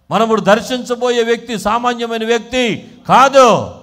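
loud, high, insistent preaching; preaching at full force. Real, not acted. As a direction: urge the congregation on forcefully.

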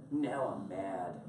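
comic slow-burn anger; mock mad. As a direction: drop low and drag it out, mock-seething. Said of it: "Why are you so mad".

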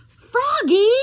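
loud high call across the room. A narrator's reading. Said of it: "called his father".

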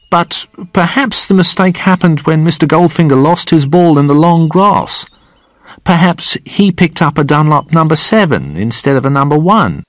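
high-pitched caddy voice; deferential caddy. A narrator's reading. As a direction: offer an explanation in a high servant's voice.

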